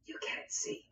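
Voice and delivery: whispered sneaky denial; hushed whisper. Acted. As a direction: breathe it out, trying to hide. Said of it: "And he says".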